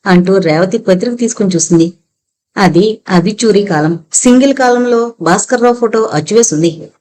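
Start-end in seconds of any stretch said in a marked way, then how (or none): none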